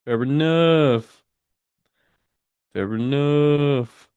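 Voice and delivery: drawn-out musing. Real, not acted. Reading chat, musing.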